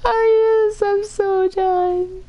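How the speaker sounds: high, strained half-crying "done"; real despairing whine; cracking up in despair. Real, not acted. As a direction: give up with a breaking voice. Breaking down over game.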